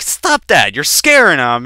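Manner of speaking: high, loud scolding; angry mom. Retold or imitated. Mom coming to her kid's aid, angrily scolding dad. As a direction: scold sharply as an imitated mother. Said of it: "she would get angry at him".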